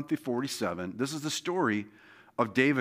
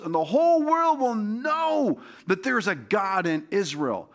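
full-force preaching; 9 semitones higher than usual. Acted. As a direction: preach it out to lift the crowd.

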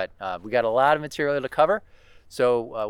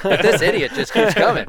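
louder, higher hissing rattlesnake warning; 6 semitones higher, 9 dB louder than usual. Pretend. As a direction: play the snake warning off an intruder. Voicing the annoyed rattlesnake.

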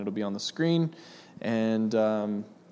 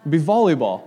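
tickled, breaking into laughter; amused laughing; 3 semitones higher, 6 dB louder than usual. Real, not acted. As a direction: say it through a laugh, louder. Joking with audience about tetherball.

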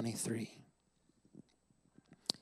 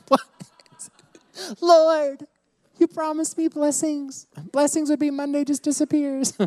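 much higher, mock whine to God; whiny Monday complaint; 13 semitones higher, 4 dB louder than usual. Pastor acting out his Monday-morning complaint to God, high mock whine. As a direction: complain to the Lord like a let-down child. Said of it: "I'm like".

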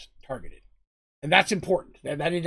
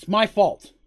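louder, higher declaration; insistent self-blame; 5 semitones higher, 9 dB louder than usual. Acted. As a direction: insist on the guilt. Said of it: "And he says".

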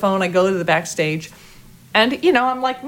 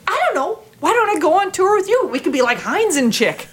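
overexcited pitch; 11 semitones higher than usual. Retold or imitated. Fan pitching a tour to Hines, excited. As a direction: blurt it out eagerly. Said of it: "I'm like".

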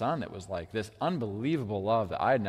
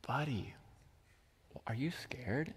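hushed, gentle question; near-whispered, caring dad voice; tender father; 13 dB quieter than usual. Retold or imitated. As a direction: softly check on a frightened child. Father-son moment at the crib.

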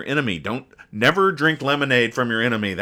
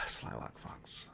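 whispered, weary; sighed whisper; 21 dB quieter, slower than usual. Real, not acted. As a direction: breathe the name out on a tired sigh. Sigh after losing the argument.

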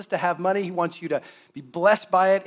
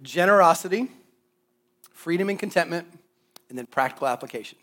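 ordinary delivery

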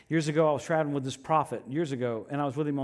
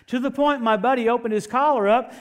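forceful, higher and louder preaching delivery; 8 semitones higher, 9 dB louder than usual. Acted. Preacher urges congregation to rise up and walk, forceful preaching. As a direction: drive it home to the congregation.

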